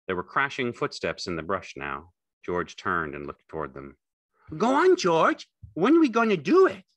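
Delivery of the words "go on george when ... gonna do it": very high, loud, impatient simple fellow; Lenny, eager and childlike. A narrator's reading. Lenny pressing George for the dream, high and loud. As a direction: press him like an excited child.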